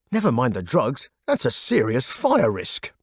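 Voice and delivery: ordinary delivery, nothing marked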